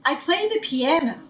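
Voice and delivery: ordinary delivery, nothing marked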